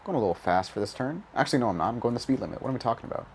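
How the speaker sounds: ordinary talk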